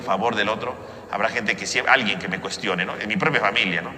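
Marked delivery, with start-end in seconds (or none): none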